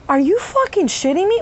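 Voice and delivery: usual speaking voice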